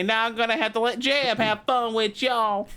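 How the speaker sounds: high, folksy Texan president voice; George Bush voice. Acted. George Bush threatening the podcast hosts, high and folksy. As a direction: raise the pitch and twang it like a president.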